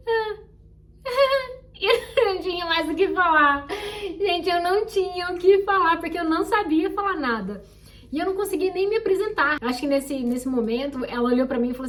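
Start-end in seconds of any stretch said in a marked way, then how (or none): 1.0-1.8 nervous laugh. Real, not acted. Riso nervoso após o "Hi. 1.8-7.6 louder, slow, half-laughing through the words; laughing while telling. Real, not acted. Conta rindo do próprio vexame.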